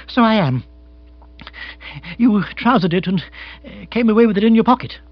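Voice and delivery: high, loud flustered character voice; agitated lord, realising. Acted. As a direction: aristocrat suddenly remembering.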